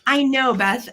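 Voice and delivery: ordinary delivery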